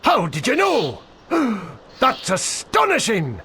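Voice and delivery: high, gasping fake astonishment; hammed-up gasp of wonder; mock amazement. Guide feigning surprise at the bard's guess, hammed-up astonishment.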